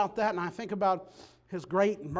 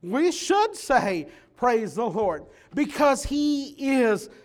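loud, higher, rousing exhortation; preaching at full force; 4 semitones higher, 9 dB louder than usual. Real, not acted. Preacher closing the message, rousing the congregation loudly. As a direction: drive it home to the church.

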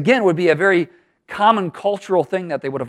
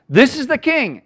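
raised, higher proclaiming voice; royal proclamation; 10 semitones higher, 5 dB louder than usual. Retold or imitated. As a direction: announce the new king to the people. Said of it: "the way he declares".

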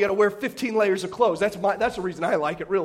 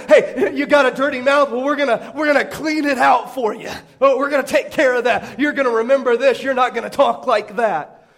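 mock stern parents; 4 semitones higher, 6 dB louder than usual. Parents voiced mockingly.

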